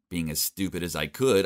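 usual speaking voice

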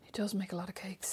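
soft whispered aside. Real, not acted. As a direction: breathe it in a whisper.

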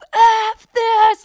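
very high, loud mock outburst; hammed-up exasperated yell; losing it. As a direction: imitate a friend exploding over nothing. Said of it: "like, losing it".

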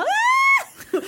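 very high-pitched mock scream; imitated panic scream. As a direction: scream like someone sliding helplessly. Imitates friend heading into the stream. (gällt härmat skrik)

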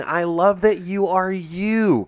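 slow, over-the-top cheerful praise; sarcastic. Pretend. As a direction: gush it with mock sincerity. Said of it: "we sound sarcastic".

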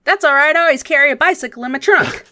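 high, loud, fast and cheerful; a chipper local with a sudden fix; Rudy's voice. Acted. Game master voicing Rudy pulling out his bicycle.

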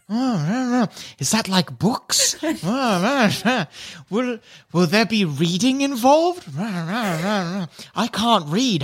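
lightly voiced muttering crowd with rhubarb filler; murmuring kobold crowd. Kobolds confer about the intelligence option, murmuring in confusion. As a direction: voice several puzzled onlookers over babble. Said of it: "start whispering to each other".

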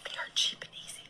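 hushed whisper. Acted. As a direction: whisper it like a secret. Whispered aside about men.

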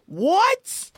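high-pitched, rising "what?" of disbelief; retold shock. Woman hearing her own accent played back, shocked. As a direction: retell being startled by your own recording. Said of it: "when I listened back, I was like".

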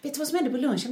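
high, eager chatter greeting her partner; mimicking herself bubbling over as her partner gets home; chatty self imitation. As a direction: gush at him the moment he walks in. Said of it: "när man kommer hem från jobbet och så bara".

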